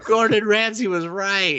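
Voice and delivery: hammed-up panic; mock panic. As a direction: fake dread over bad food. Character panics after eating raw goose.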